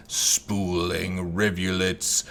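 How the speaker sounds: usual speaking voice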